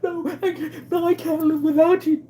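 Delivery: very high, loud, whiny mock plea; hammed-up wailing please-don't-leave-me; mock desperate begging. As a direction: beg like a pathetic dumped boyfriend, hammed-up. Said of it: "he has to go".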